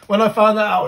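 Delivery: loud, high, bursting with excitement; excited. Real, not acted. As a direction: blurt it out, too thrilled to hold back. Hyped-up retelling of big news.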